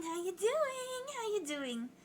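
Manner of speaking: very high, slow drawn-out greeting; playful high slow how you doing; high sing-song. Acted. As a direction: stretch it out way up high. Repeated greeting, voice played.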